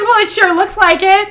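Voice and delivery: high mock sarcasm; sarcastic lady. Owner doubting the mother's excuse, high sarcastic. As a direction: throw back a dry accusation. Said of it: "She goes".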